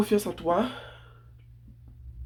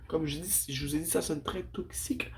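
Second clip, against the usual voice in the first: whispering; 7 dB quieter than usual. Real, not acted. Whispered aside about sounding toxic.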